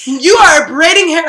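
loud, high scolding in imitation; mock shouting. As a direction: call her out at full volume. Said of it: "she's shouting so loud".

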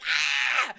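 sharp short yell of fright; startled scream. Retold or imitated. Jumping as her mom pops out at her, startled yell. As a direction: let out a quick startled yelp. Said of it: "I screamed".

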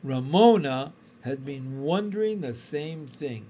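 plain reading voice

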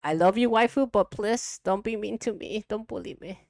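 whiny plea. Pretend. Pleading playfully with co-host.

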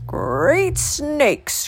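put-on high-pitched old man's croak. A narrator's reading. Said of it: "croaked the old man".

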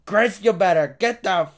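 mock angry mom, slow and loud; mom scolding. Mom yelling at her son through the door. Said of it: "she was like".

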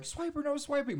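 put-on very high, loud child shout; kid voice. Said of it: "I'd be like".

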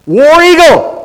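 a big rallying yell, high and loud; game-day battle cry; shouted cheer. Shown as a demonstration. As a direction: belt it like a football fan. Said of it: "I could say this".

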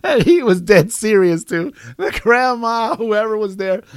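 loud, high, cracking up while talking; real laughing. Real, not acted. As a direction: push the words out through laughter. Cracking up after the punchline.

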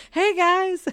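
high, loud cheery hey guys; loud mock greeting. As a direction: call out brightly to strangers. Mock greeting to German family.

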